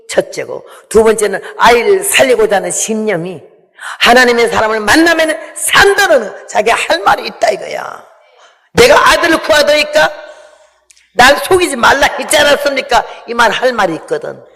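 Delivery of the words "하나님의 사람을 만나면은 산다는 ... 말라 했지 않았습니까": high, loud impassioned plea; preaching at full force; fervent preaching. Acted. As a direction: cry out her case to the prophet.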